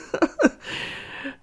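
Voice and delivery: a breathy little giggle; soft giggle. Acted. Nicole giggles at tied-up Patrick's confusion, soft giggle. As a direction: giggle softly, amused at his confusion. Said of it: "Another small giggle escapes her lips".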